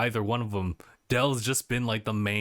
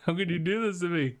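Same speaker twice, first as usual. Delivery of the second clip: mock anguish; 7 semitones higher, faster than usual. Mock complaint about the forced choice.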